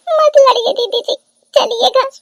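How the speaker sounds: high, whimpering plea; tearful pleading. Acted. Weeping bride begs didi to stop fighting, tearful. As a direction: plead through sniffles, pitched high.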